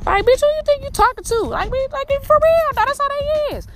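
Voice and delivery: high, sassy put-on voice; squeaky mock outrage at haters; mock indignant diva. Mocking, high indignant voicing.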